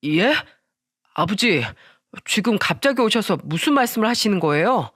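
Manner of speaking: ordinary delivery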